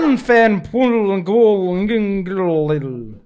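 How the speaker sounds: Lanfen Pulngol Ngingl read out very slowly and loud; slow, careful Welsh name attempt; attempting Welsh place name. Shown as a demonstration. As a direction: sound out a Welsh town name syllable by syllable. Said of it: "try and pronounce one".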